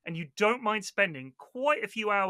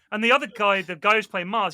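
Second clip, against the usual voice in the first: ordinary talk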